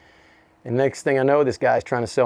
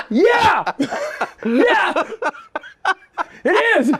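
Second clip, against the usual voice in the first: laughing yes; much slower than usual. Retold or imitated. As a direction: laugh out the admission. Admitting identity while laughing.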